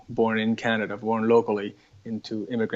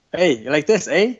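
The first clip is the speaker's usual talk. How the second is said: light Canadian parody, a bit higher and louder; mock Canadian accent; 5 semitones higher, 6 dB louder than usual. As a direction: tease the Canadian "eh", lightly put-on. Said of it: "Canadians say a lot of "eh".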